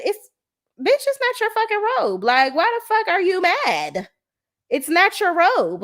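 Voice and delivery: exasperated rant. Real, not acted. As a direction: scold her for fussing over a robe, pitch way up. Ranting at cast member on TV.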